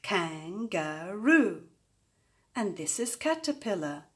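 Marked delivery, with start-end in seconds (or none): 0.0-1.6 "Kangaroo" drawn out slowly in three beats; slow syllable-by-syllable "Kangaroo"; three separate beats. Shown as a demonstration. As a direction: stretch "Kangaroo" into Kan-ga-roo, beat by beat. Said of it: "three beats like this".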